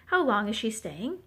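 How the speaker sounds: usual speaking voice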